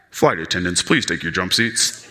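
very low, level pilot announcement; pilot over intercom. Acted. Pilot to flight attendants over the intercom, low announcement voice. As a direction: drop into a deep cockpit-announcement voice. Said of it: "the pilot say over the intercom".

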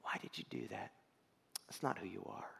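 soft whispered correction; gentle God. Acted. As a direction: whisper it with tender love. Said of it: "huge difference between God saying".

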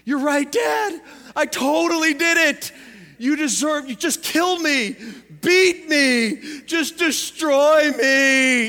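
high, loud, hammed-up martyr begging for punishment; mock-tragic son surrendering to his dad; playing martyr. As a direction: throw yourself on the sword, loud and slow at the end. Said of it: "I'm gonna play martyrdom".

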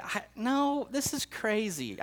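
high, slow incredulous protest; disbelief. Retold or imitated. Waking from a bad dream, he protests to himself in high disbelief. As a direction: push the idea away in disbelief.

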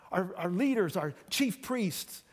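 normal talking voice

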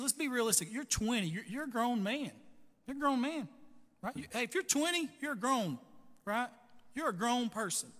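a grown man told straight; firm mentor. Retold or imitated. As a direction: press the point, smiling. Mentor challenges a young man.

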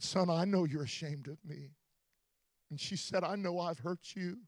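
mother's tearful words, spoken softly; mother crying. Retold or imitated. Mother to her preacher son, quiet and tearful. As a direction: let her break softly as she confesses. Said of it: "my mom began to cry".

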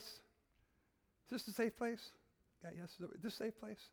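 slow right down and ask gently; slow, soft asking for trust; slow soft repeat. Shown as a demonstration.

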